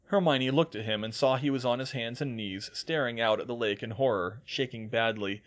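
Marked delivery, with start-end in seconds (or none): none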